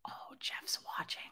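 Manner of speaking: real whisper, hushed. Real, not acted. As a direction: keep it hushed so Jeff won't hear. Noticing husband upstairs.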